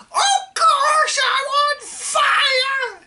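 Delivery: very high, loud, slow screech; old woman yelling. Acted. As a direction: scream back at the boy indignantly. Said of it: "she yelled".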